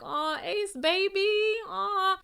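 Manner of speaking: high, drawn-out pitying aww; sympathetic coo. Retold or imitated. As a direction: coo slowly over a sad little one. Coos in sympathy for crying child.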